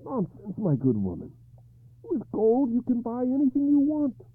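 King Arthur, troubled, telling his knight the terms; a lightly voiced anxious king; Arthur's worried voice. Arthur offering riches.

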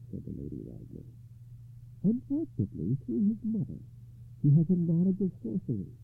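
a calm lady explaining her curse; lady recounting. A narrator's reading.

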